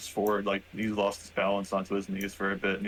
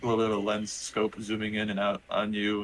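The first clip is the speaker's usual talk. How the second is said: usual speaking voice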